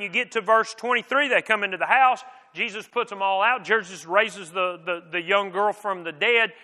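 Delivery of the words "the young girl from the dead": usual speaking voice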